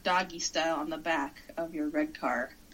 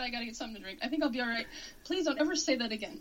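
usual speaking voice

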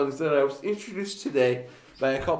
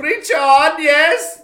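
mock cheer; 12 semitones higher, 12 dB louder, much slower than usual.

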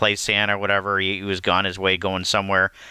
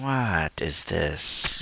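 slow, softer head-shaking disbelief; muttered dismay; 5 semitones lower, 5 dB quieter, much slower than usual. Retold or imitated. Viewer shaking head at the ending, slow soft dismay.

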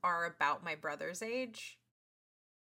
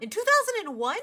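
higher, louder disbelieving question; incredulous; 10 semitones higher, 8 dB louder than usual. Real, not acted. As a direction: ask it in loud disbelief. Incredulous at Matt's age.

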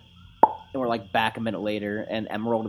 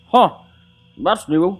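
Grubble; 9 semitones higher, 12 dB louder, much slower than usual. Acted. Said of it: "Grubble looks ... He goes".

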